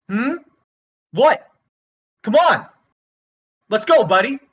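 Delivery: short, confrontational bursts; aggressive challenge. Acted. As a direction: dare the heckler to step up. Comedian dares heckler to come up.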